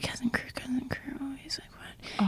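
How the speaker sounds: whispered reading to oneself; hushed, confused mimicry; imitated puzzled whisper. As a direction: whisper it as if working it out. Dad reading shirts to himself, puzzled.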